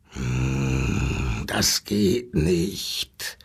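put-on deep, slow growl of a stubborn old wolf. A narrator's reading. Old wolf grumbling back at the zookeeper, deep slow growl. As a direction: rumble it low like a grey wolf refusing. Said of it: "knurrte der alte Graue".

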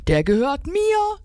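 high indignant squeal; squealing squirrel. A narrator's reading. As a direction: squeal it high, claiming it as yours. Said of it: "quietschte das Eichhörnchen".

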